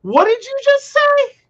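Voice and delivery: high, disbelieving what-did-you-say; mock disbelief at a coworker; incredulous. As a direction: retell the shock with a sharp lift in pitch. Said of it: "I'm just sitting there going".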